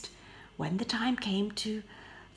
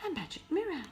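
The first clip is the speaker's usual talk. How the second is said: higher voice of delighted discovery; wonder; 8 semitones higher, much faster than usual. Acted. Beauty discovering a magic mirror, in delighted wonder. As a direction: marvel at the mirror.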